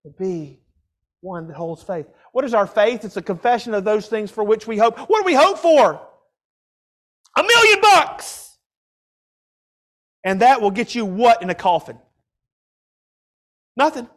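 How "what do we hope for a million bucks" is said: high, raised-voice exclamation; mock excitement. Preacher crying out a worldly hope to the congregation, mock excitement. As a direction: shout it out like a prize announced.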